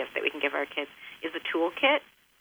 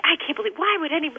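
huffy; 9 semitones higher than usual. Retold or imitated. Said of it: "I get all huffy".

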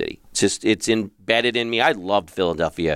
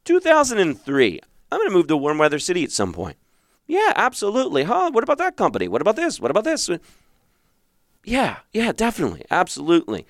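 mock eager self; 9 semitones higher than usual. Mimicking his own empty optimism.

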